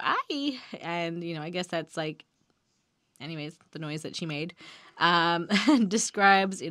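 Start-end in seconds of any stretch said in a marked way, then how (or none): 0.0-0.6 a small, pitched-up imitation scream; mock scream. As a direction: squeal it lightly, imitating her cry. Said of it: "she screams out".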